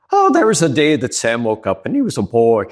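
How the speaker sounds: put-on lilting Irish storyteller accent. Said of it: "an Irish accent".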